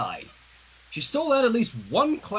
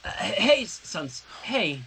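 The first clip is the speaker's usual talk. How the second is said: stammering; much slower than usual. Acted. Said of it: "Sunset stammered".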